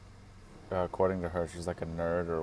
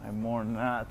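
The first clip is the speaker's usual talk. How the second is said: mock solemn; 3 semitones higher than usual. Repeats phrase in mock solemn voice.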